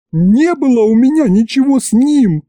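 high, loud wail of denial; wailing yell. A narrator's reading. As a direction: yell it out in outrage. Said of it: "завопила вдруг она". (завопила, отпираясь)

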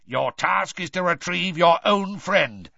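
merman voice. Acted. Merman lays down the rule.

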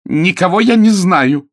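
high, loud cry of denial; shouting denial. A narrator's reading. Clara denying to her friend, shouting. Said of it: "крикнула она".

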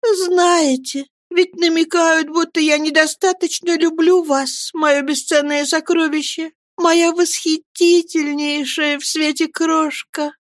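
high, slow caressing voice; tender mother. A narrator's reading. Mother caresses Davy, high and tender. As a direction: coo over the child.

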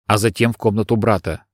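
ordinary delivery

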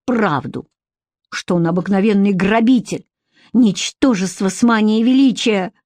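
plain reading voice

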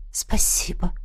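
faint whispered thanks; hushed, voiceless gratitude. A narrator's reading. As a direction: whisper it gratefully. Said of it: "прошептала Кристалиара".

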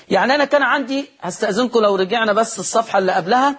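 ordinary talk, nothing marked

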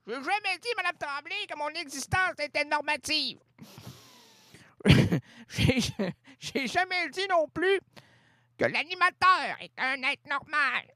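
high, quivering mock-tragic voice; grand tragic stage delivery. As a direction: play it like a stage tragedy about to cry. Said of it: "je prends une voix de même, parce que je vais pleurer". (voix tragique de théâtre, au bord des larmes)

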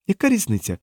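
high-pitched brush-off; dismissive. A narrator's reading. Diana brushes off the man's warning, lightly dismissive. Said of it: "відмахнулася Діана".

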